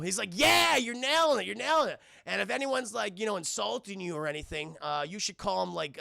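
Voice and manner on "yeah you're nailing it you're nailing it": put-on high, loud kid cheering; mock excited praise. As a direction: hype him up like a proud kid. John cheering robot on.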